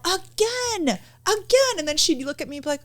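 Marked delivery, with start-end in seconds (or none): 0.0-1.7 high-pitched holler held back in volume; mock yell. Mother across the lacrosse field after a score, mock yelling. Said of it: "I would yell".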